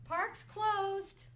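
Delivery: loud but kindly told park's closed; steady announcement, no anger, just firm; firm police order. Retold or imitated. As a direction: call it out like a patrol officer clearing the park. Voicing police ordering Martin out.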